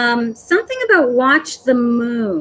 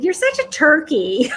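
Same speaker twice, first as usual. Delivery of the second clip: lightly mocking, pitched higher; playful teasing; 4 semitones higher than usual. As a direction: poke fun with a lifted, sing-out tease. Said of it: "He's making fun of me".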